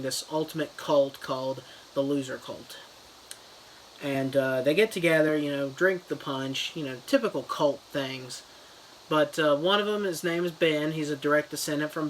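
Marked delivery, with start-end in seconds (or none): none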